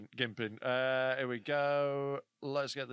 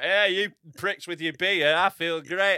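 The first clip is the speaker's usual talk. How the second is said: loud, slow triumphant boast at the drinkers; loud slow mock-gloat; gloating pub boast; 2 semitones higher, 10 dB louder, slower than usual. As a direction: crow it at the beer drinkers.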